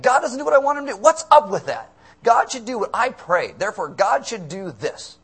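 high, loud mock outrage; indignant demand. Believer demanding God obey their prayer, mock indignant. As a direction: protest like someone owed an answer.

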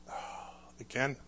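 soft, breathy, tired slow sigh of a question; a mother who has heard this before; slow, soft, worn-out mom; weary mother. Retold or imitated. Mom hears he's in jail again.

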